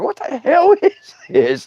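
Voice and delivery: high, drawn-out disbelief; astonished. Retold or imitated. Campfire storyteller reacting to the strange noise, high astonishment. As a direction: stretch it out in baffled amazement.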